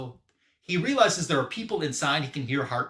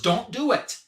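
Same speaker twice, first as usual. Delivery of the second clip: retold yell, higher and louder; Superman; 9 semitones higher, 5 dB louder than usual. Hero tries to stop the attackers, yelling. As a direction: call out an urgent warning. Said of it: "And Superman yells".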